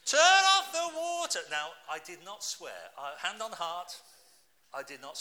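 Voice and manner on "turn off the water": furious loud yell; angry shout. Retold or imitated. Preacher shouting at his wife to stop the water. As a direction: bellow it out in anger. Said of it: "I shouted".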